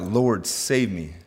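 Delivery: usual speaking voice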